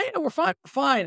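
imitating a carefree investor; breezy reassurance.

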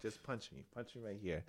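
soft, hushed request; quiet. Retold or imitated. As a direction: say it under your breath. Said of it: "I'm like".

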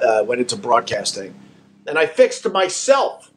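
ordinary talk, nothing marked

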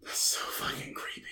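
creepy whisper. Real, not acted. Host reacting to the ghost voice, whispered. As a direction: breathe it out, spooked.

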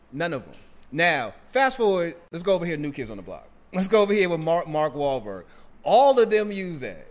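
loud and rising, making the point; fired up. Real, not acted. Heated point about Boston accents.

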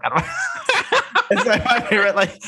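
high giggling through the words; real laughter breaking up the quote; laughing. Real, not acted. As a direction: laugh the line out, cracking up.

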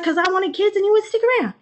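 high, fast retort; mock Eve. Eve fighting with Adam, high and fast. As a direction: snap back in a high mock voice.

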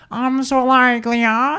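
high, garbled imitation of an American accent; marbles-in-mouth accent. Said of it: "sounds like you have marbles in your mouth".

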